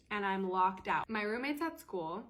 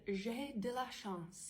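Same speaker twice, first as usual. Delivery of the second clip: slow, soft careful French; halting French; 3 semitones higher, 5 dB quieter, slower than usual. Retold or imitated. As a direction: sound out the French phrase hesitantly. Trying French with host family.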